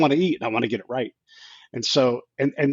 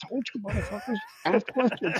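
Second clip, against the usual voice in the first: high, laughing mock gloat; 9 semitones higher, 6 dB quieter than usual. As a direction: crow at your buddies through a laugh.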